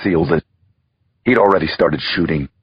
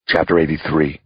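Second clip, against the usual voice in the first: ordinary delivery, nothing marked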